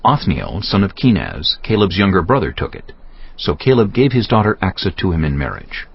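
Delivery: ordinary talk, nothing marked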